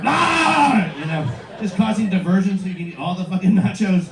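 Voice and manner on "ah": high, drawn-out "aah" of fake alarm; mock alarmed yell. Diner distracting friends from the nachos, high mock scream. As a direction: yell out to make a diversion.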